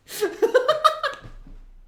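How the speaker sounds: shrieking giggle fit; high helpless laugh. Real, not acted. Comedian cracking up at his own story, high squealing laugh.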